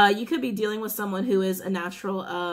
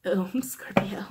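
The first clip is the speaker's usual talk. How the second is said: whispered laugh; slower than usual. Real, not acted. Quiet laugh at the reading.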